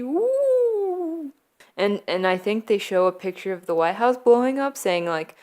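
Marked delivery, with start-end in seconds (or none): 0.0-1.3 long, high-pitched "wooo"; mock spooky woo. Hackers menacing the country on TV, mock spooky woo. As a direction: hold a silly ghostly hoot up high.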